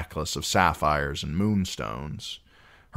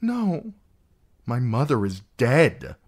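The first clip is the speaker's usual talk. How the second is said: put-on higher, strained girl's voice; a niece defending her dead mother; held-back shriek; 7 semitones higher, 3 dB louder than usual. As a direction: choke back a scream. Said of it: "she wanted to shriek".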